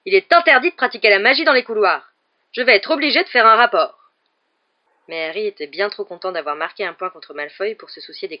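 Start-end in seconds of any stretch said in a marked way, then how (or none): 0.0-4.0 loud, high officious scolding; raised voice laying down the rules; shouted reprimand. A narrator's reading. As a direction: scold him with a prefect's indignation. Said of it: "s'écria Percy".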